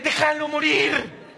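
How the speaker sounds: "Déjalo morir" drawn out slowly, leaning on "Déjalo"; slow emphatic plea. Acted. Comic telling wives to leave husbands alone, slow pleading.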